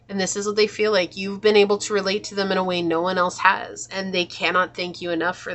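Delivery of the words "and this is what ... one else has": ordinary delivery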